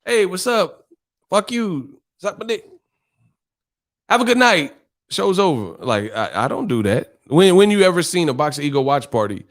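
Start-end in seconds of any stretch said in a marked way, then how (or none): 0.0-5.8 mock rude send-off. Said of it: "You know".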